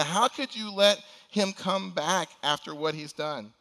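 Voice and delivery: a resentful son confronting his father; angry challenge. Acted. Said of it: "he challenges his father".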